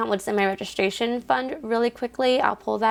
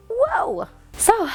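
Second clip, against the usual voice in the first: high-pitched exclamation of surprise; astonished; 12 semitones higher than usual. Real, not acted. Vlogger takes in her weekly earnings, astonished. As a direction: let the voice leap up in amazement. Reacting to the final total.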